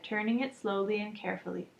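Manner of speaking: ordinary delivery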